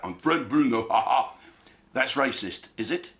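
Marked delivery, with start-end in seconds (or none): 0.0-1.3 a light imitation of the boxer, ending in a chuckle; hint of the famous boxer's voice, then laugh; Frank Bruno impression. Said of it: "if I do an impression of Frank Bruno".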